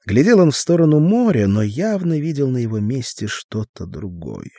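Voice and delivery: ordinary delivery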